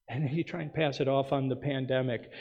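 usual speaking voice

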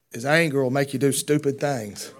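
ordinary talk, nothing marked

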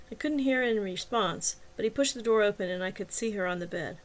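plain reading voice

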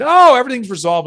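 high, loud, breezy pretend optimism; mock relief. Mocks an imagined instant fix.

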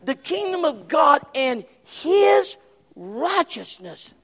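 slow, loud preacher's cry; preaching shout. Acted. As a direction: proclaim it slowly to the congregation. Preaching at full force.

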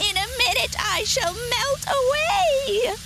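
high, loud panicked shriek; screaming witch. A narrator's reading. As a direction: wail shrilly as the melting witch. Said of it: "she screamed".

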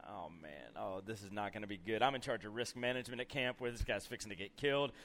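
low, soft dread-filled aside; worried inner muttering. Retold or imitated. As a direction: mutter a worried thought to yourself. Retold worried thought during fight.

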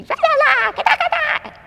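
very high, loud garbled mock yelling; gritos a los niños. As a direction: imitate an angry mom's shrieked scolding. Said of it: "empecé a gritarles". (gritos agudos de regaño imitados)

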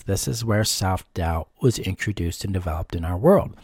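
normal talking voice

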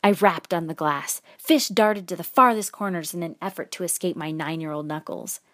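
usual speaking voice

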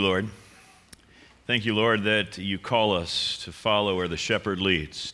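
soft, low prayer voice. Acted. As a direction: pray quietly and reverently. Pastor leads opening prayer.

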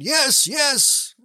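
high, excited crowd cheer; crowd shouting. Retold or imitated. Crowd at Niagara shouting yes to Blondin, high excited cheer. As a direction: call it out like an eager crowd. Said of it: "the crowd shouted".

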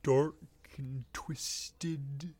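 slow halting comic drawl; Emo Phillips voice. Acted. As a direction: draw words out with odd pauses. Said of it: "I'm doing Emo Phillips".

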